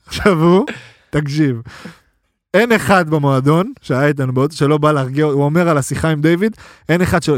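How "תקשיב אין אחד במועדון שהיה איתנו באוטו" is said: normal talking voice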